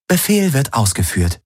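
high, neutral onboard computer reading out measurements; ship computer. Acted. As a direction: report data like a calm machine. Ship's computer confirms.

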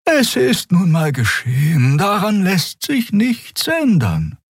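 high, slow, calming voice; soothing Xea. A narrator's reading. Xea calming an angry crewmate, slow and soothing. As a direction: soothe slowly, pitched high. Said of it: "Xeas Stimme war wie Balsam".

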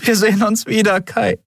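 high, emotional promise; high-voiced parting vow; promising. A narrator's reading. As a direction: promise it earnestly, pitched high. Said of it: "versprach sie".

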